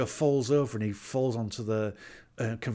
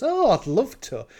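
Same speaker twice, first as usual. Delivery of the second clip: retold eager acceptance; 10 semitones higher, 6 dB louder than usual. Retold eager acceptance in film scene.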